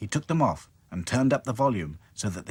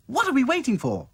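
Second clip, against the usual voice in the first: put-on much higher, louder girl doll; eager, calling everyone on; excited cry; 6 dB louder, faster than usual. Dotty urges the dolls in an excited cry. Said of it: "cried Dotty".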